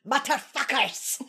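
drawn-out fake German accent; hammed-up German pronunciation; mock German accent. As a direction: say it slowly like a cartoon German. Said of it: "I'm going to be German".

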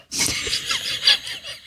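high airy burst of laughter; cracking up at the bike memory. Real, not acted. Laughing with the host about the hill, high and airy.

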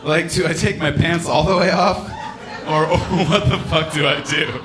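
high, loud, laughing bewilderment; laughing panic. Real, not acted. Comic cracking up while asking about toilets, loud laughing.

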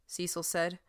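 ordinary delivery, nothing marked

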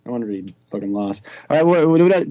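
ordinary talk, nothing marked

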